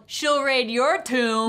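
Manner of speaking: loud, slow quoted holler; retold yell. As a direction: imitate a slow shouted comeback. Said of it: "No, you yelled".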